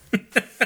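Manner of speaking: high laugh bursting out; burst of laughter. Real, not acted. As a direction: crack up laughing. Laughs at own joke.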